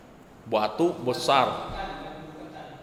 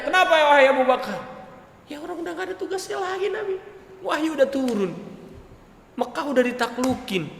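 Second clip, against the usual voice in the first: tearful explanation; 11 semitones higher than usual. Retold or imitated. Abu Bakar explains, voice breaking.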